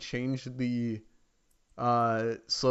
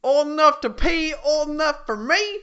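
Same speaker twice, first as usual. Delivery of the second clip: much higher, louder mock creep voice; creepy joke voice; 13 semitones higher, 7 dB louder than usual. Joking with a friend, hammed-up high creep voice. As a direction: ham up a sleazy creep chanting it.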